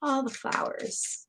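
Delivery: slow, breathy whispered aside. Real, not acted. Whispering love to a grieving viewer, slow. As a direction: breathe it out slowly, barely voiced.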